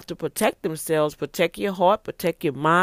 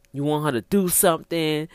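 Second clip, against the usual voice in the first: ordinary talk, nothing marked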